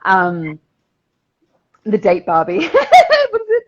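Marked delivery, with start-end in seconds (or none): 1.8-3.7 laughing hard, much louder and higher. Real, not acted. Reading a viewer's comment, laughing. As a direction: laugh through the words.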